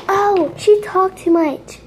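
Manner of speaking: gasp, then high drawn-out mock dismay; mock complaint. Mimicking viewers' complaint about long video.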